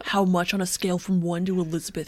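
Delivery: mock-serious founder tone; Elizabeth Holmes. Co-host testing her impression, throaty and self-important. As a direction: ask it in a throaty put-on voice. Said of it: "rest of the show in this tone".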